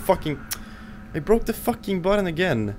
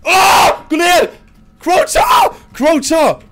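panicked high screaming; frantic yelling at teammate; scared horror game streamer; 7 dB louder, slower than usual. Real, not acted.

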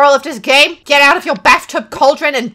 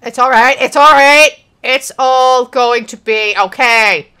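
forceful, louder insistence that it's fine; loud self-reassurance; 5 dB louder than usual. Real, not acted. Gamer frantically reassuring self, loud insistence. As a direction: force the comfort out loudly.

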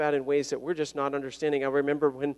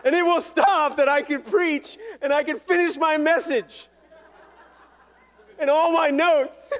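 high, loud words through laughter; chuckling high and loud; laughing; 12 semitones higher, 6 dB louder than usual. Real, not acted. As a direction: laugh your way through the joke. Joking about finishing his sermon.

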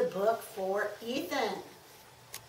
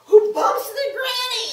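dramatic excitement; 12 semitones higher, 12 dB louder than usual. A narrator's reading. Dramatic story build-up.

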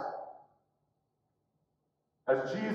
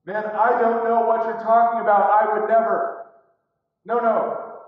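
loud, insistent protest; defensive, louder outburst; vehement denial; 2 semitones higher, 6 dB louder than usual. Retold or imitated. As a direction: deny all of it forcefully. Peter's third denial.

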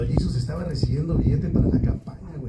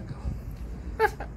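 whispered curse; 11 dB quieter than usual. Retold or imitated. Whispered plea to God.

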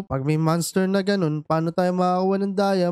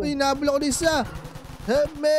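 panicked call; 7 semitones higher, faster than usual. Real, not acted. Chased by a monster.

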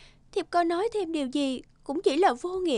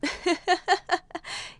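a light pleased laugh; a short delighted fairy laugh; 4 semitones higher than usual. Acted. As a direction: laugh gently, charmed by his words.

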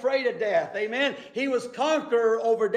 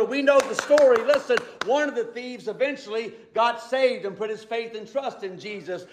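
ordinary delivery

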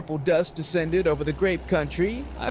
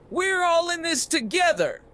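much higher, hearty rallying call; yelled; 10 semitones higher than usual. A narrator's reading. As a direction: cheer the gang on. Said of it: "yelled Ponzo".

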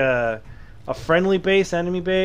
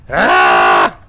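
frustrated shout; 10 dB louder than usual. Real, not acted.

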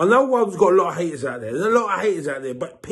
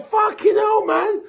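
amazed shout; 12 semitones higher, slower than usual. Retold or imitated. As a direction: blurt it out like a stunned fan. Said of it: "And we're like".

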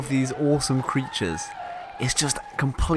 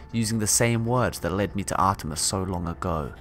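calm reading of a log; story narration; 4 semitones lower, 4 dB quieter than usual. Acted. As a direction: read it like a captain's journal. Reads game log aloud.

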